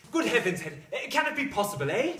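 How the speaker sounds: loud, amazed exclamation; joyful shout. Acted. Husband guesses his wife's news, astonished shout. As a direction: burst out in astonished delight. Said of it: "Don't shout so".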